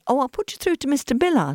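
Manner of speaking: lightly voiced receptionist, high lilt; a hint of switchboard sing-song; receptionist on the phone. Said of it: "this voice said".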